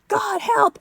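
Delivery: very high, loud cry for help; imitated panicked child's shriek; child's scream. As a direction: scream it like a terrified little boy. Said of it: "And he screamed".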